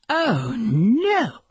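high, wailing diva dismay; dramatic cry of dismay. A narrator's reading. Movie star spotting photographers, dramatic dismayed cry. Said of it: "Miss Garbark cried".

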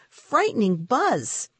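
ordinary delivery

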